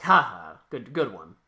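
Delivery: low mock chuckle, loud at first; laughing it off. Captured hero brushing off his defeat, imitated dismissive chuckle. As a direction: feign a casual laugh, then concede. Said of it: "laughs it off".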